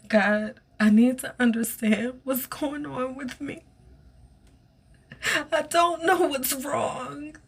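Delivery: high, tearful plea to God; a high, teary plea; tearful pleading. Retold or imitated. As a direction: beg God for answers, close to tears. Pleading prayer in the shower.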